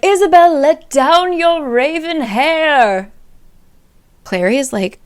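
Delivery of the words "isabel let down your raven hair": loud, high, slow theatrical call; drunken serenade call. Retold or imitated. Simon calling up to Isabel's window, loud drunken serenade.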